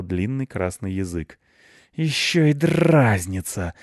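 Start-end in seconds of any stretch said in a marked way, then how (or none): 1.9-3.7 slow, high, louder cat hiss; angry hissing. A narrator's reading. Cat teased by the dog, hissing angrily. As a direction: hiss it out in outrage. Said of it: "зашипел Рыжопиус".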